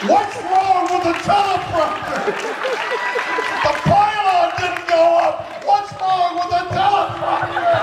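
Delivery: high, frantic cries about the teleprompter; mock panic. Shatner reliving his teleprompter panic in a hammed-up frantic voice. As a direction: shriek it in hammed-up alarm.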